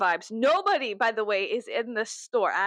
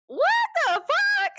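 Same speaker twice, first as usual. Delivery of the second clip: shocked outburst; 4 dB louder, slower than usual. Retold or imitated. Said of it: "And I was like".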